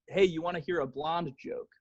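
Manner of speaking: ordinary talk, nothing marked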